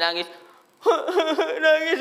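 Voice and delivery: high mock whimper of a child; mock crying.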